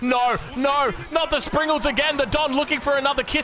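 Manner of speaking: high-pitched, urgent commentary; alarmed play-by-play. Real, not acted. Caster watching both Springalds get sniped, alarmed play-by-play. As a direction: call the disaster as it happens.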